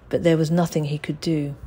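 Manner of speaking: plain reading voice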